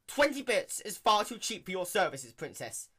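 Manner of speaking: loud, high nervous blurt. Acted. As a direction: blurt it out in a panic. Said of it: "he blurted out".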